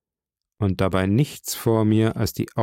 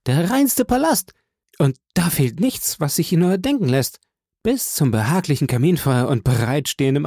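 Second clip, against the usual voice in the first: higher, lively friend voice sinking into despair; a broke friend envying the rich man's flat, higher and moving; Hastings, marvelling then despairing; 3 semitones higher than usual. Acted. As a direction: gush over the room, then break down.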